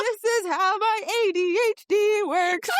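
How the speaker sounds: high, loud, slow words through laughter; laughing. Real, not acted. Cracking up at her own scattered brain, laughing. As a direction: squeal it out while cracking up.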